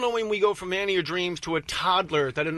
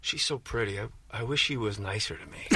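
wistful inner thought; 7 semitones lower, 6 dB quieter than usual. Retold or imitated. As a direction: lower and softer, a sad little wish. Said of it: "we're thinking".

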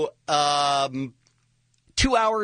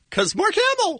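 excited. Real, not acted. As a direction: belt the name with glee. Excited about the celebrity.

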